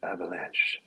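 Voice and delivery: soft whispered Avalanche. Acted. Guest savoring the cigar name, whisper. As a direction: breathe the word like a secret.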